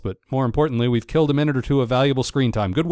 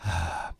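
lovesick sigh; 7 semitones lower, 10 dB quieter than usual. Real, not acted. Swooning over the Baroness.

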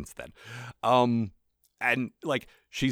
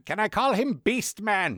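high, slow villain asking permission; cartoon villain voice; 12 semitones higher than usual. Podcaster as villain actor asking about a name, high cartoon voice. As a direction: plead it high and drawn out. Acting out Skeletor's actor naming character.

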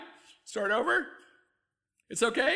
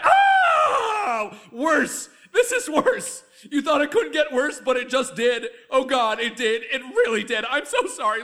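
hysterical panic; 7 semitones higher, 8 dB louder than usual. Acted. Spiralling panic after another slip.

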